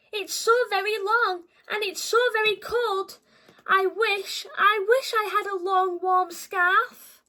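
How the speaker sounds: sniffly, mournful giraffe; sad giraffe. Acted. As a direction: whimper out the wish for a scarf. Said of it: "who was sniffing sadly".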